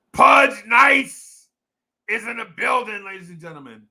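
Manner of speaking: loud, high hype-man shout dropping off; announcer intro. Pretend. Hyping a chat member's arrival, announcer shout. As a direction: announce a star entering the building.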